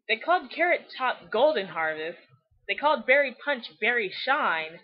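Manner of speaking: normal talking voice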